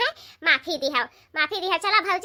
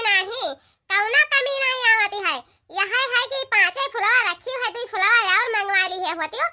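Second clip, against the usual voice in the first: lightly raised woman's voice, pleased; bhauji voice; 6 semitones higher than usual. Acted. Woman asking elder if anything is missing. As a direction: chat proudly about the shrine, a bit higher. Sister-in-law voice, higher.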